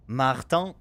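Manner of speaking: ordinary talk, nothing marked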